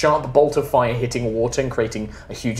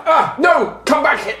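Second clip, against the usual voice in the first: fake protest shout; 14 semitones higher, 4 dB louder than usual. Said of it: "you basically hear".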